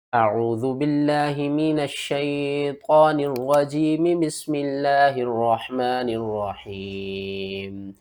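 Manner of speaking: slow, measured recitation; opening tilawat before the lesson; Quranic recitation. Acted. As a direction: recite it slowly and reverently. Opens with recitation.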